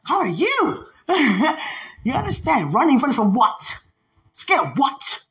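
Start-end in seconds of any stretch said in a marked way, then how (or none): 0.0-1.6 high, over-eager greeting; over-excited. Retold or imitated. Speaker greeting people in witch towns, over-excited. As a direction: greet them with bubbling, high-pitched eagerness. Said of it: "I got so excited".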